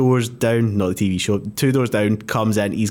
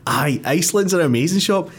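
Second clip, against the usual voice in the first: mock excited; 6 semitones higher than usual. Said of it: "excitedly turned round to me and went".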